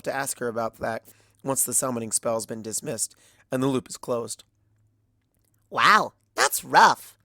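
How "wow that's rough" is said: slow, louder drawn-out wow; sympathetic Pinkie. A narrator's reading. Pinkie reacting to Starswirl's fate, slow and loud. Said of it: "says Pinkie".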